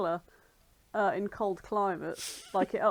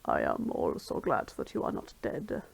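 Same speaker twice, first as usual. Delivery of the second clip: much lower, breathy dry bird voice; Rivali voice. Acted. Rivali greeting Zelda, low and grudging.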